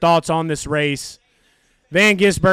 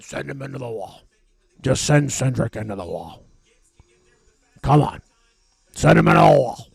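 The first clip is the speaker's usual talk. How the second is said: low, terse owner voice, much deeper than usual; team owner's radio order; 7 semitones lower than usual. Acted. Roger on the radio wants a caution, low and gruff. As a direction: mutter it low like a boss giving a shady order. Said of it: "Roger said".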